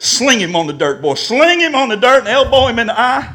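loud, high-pitched retold urge to fight; a worked-up inner voice egging him on; angry inner urge. Old self urging him to fight the man, angry and loud. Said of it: "I was so mad".

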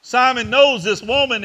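fervent preacher building up; preaching at full force. Acted. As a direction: press each line home to the church. Preaching climax on Simon.

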